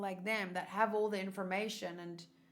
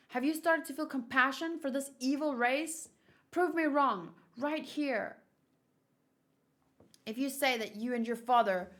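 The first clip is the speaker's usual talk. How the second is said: higher, harsh, pushing voices; tense soldiers arguing; 5 semitones higher, 7 dB louder than usual. Acted. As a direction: demand proof, voice raised.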